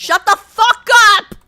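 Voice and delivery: high, loud and slow, spitting each word; all-out furious yell; furious shout. Pretend. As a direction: scream it at the nag to shut her up. Yells back at nagging strategist.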